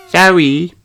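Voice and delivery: high little sheep voice, hopeful; hopeful offer. A narrator's reading. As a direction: coax Zoe to be friends. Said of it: "says Susie".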